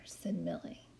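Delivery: gentle read-aloud storytime voice; story narration. A narrator's reading. Librarian reading a picture book to kids, storytime narration.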